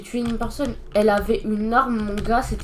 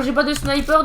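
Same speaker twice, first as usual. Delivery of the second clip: ordinary delivery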